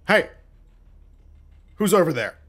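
suspicious shouted challenge; yell out. Acted. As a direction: shout a challenge at someone unseen. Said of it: "hear someone yell out".

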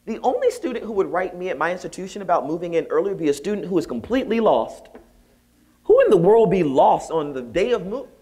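high and building to loud incredulity; dawning realization. Retold or imitated. Professor reasoning out who wrote, increasingly incredulous. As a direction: work it out with rising, acted disbelief.

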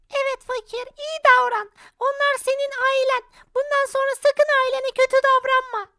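high, gentle advice; high spirit voice. Acted. Selena telling Fakir to be kind, high voice. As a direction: soothe him in a high voice.

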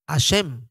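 HASHEM with the initial H dropped, "a-SHEM"; silent H. Shown as a demonstration. Teacher showing how some say Hashem, silent H. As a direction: say "Hashem" starting straight on the vowel. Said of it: "algunos no la pronuncian".

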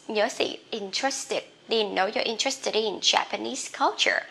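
usual speaking voice

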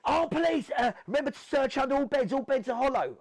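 loud, high shouted commands; police shout. Retold or imitated. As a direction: bark orders like raiding officers. Police shouting during raid.

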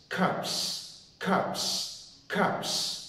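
cups said unvoiced in a whisper; whispered cups repeated as a drill. Shown as a demonstration. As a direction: breathe out each cups with no voice. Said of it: "mình phải bật hơi chữ K đó".

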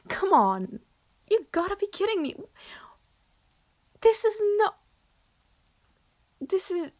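high, loud disbelieving outburst with a scoffing laugh; exasperated outburst. Acted. To the admirer calling her a tsundere, a high exasperated outburst. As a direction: explode in disbelief, voice shooting up. Outraged at being called tsundere.

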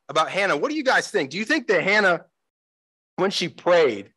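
ordinary talk, nothing marked

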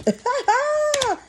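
quick high laugh that falls away; a short amused cackle. Real, not acted. Joking to viewers about snakes, high laugh.

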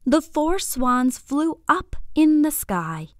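usual speaking voice

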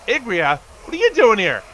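real surprise; surprised call. Real, not acted. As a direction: greet a sudden arrival in disbelief. Surprised to see a character.

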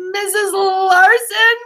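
put-on high, slow, trembling child's plea; a sick little girl's shaky call for help; high and slow, voice wobbling with dread; quavering. A narrator's reading. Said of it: "quavered Ramona".

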